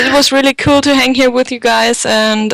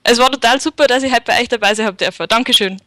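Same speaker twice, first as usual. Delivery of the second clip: Bavarian dialect; faster than usual. Shown as a demonstration. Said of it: "Should I say something in Bavarian?".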